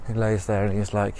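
ordinary talk, nothing marked

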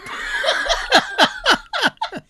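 big high laugh; loud high laughter. Real, not acted. Mom slept well.

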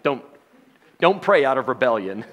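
ordinary delivery

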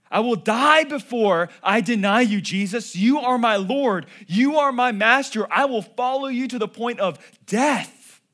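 loud, passionate promise of loyalty; fervent vow. Acted. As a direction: declare it with all your heart, loud. Peter's fervent vow to Jesus.

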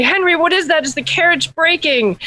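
high, urgent storybook prince; alarmed prince. Acted. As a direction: call to your servant in high-pitched alarm. Prince calls to servant in alarm.

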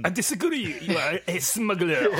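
high, hammed-up French cop voice; French police officer accusing the traveller, mock French accent. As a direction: play a sneering French officer.

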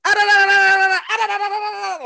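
high, loud gibberish yelling; comic impression of a man yelling in an unknown language; mock foreign yelling. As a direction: bark nonsense syllables like an angry stranger. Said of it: "a guy starts yelling".

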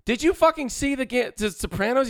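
high, urgent question to his dad; blurting it out to a half-awake dad; excited disbelief. Retold or imitated. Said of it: "I'm like".

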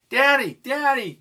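high, loud cry for daddy; a young girl calling out to her father; little girl shouts for her daddy; child's excited yell. A narrator's reading. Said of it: "yelled Marissa".

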